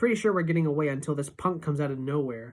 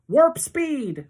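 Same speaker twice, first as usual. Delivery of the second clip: loud, much higher cry; shouting; 11 semitones higher, 8 dB louder than usual. A narrator's reading. Boy urging his friend to run straight: loud captain's cry. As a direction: call for top speed like a captain. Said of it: "Freak is shouting".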